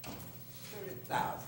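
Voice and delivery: breathy whisper, drawn out slowly. Real, not acted. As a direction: let it out on a slow whisper. Disgusted aside after complaint.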